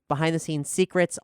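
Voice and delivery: usual speaking voice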